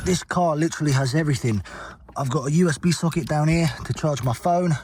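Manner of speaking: ordinary talk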